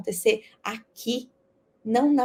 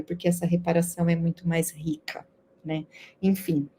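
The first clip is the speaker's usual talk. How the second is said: aparte sussurrado, mais baixo; sussurro; 5 semitones lower, 5 dB quieter than usual. Real, not acted. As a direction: sussurre como um comentário à parte. Comentário à parte no fim. (sussurrando um aparte)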